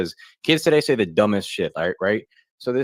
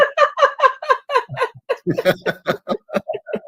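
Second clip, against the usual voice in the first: squealing, high-pitched laugh; high wheezing laugh; 4 dB louder than usual. Real, not acted. Comic laughing at his friend's story in a shrill squeal. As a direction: cackle up high at your own joke.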